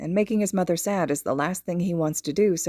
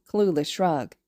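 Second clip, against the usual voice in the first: usual speaking voice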